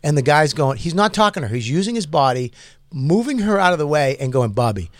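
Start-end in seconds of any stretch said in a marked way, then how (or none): 4.5-4.9 low, soft, soothing murmur; imitated reassurance. Big fan calming the comic while blocking the woman, low soothing voice. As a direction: talk him down gently under your breath.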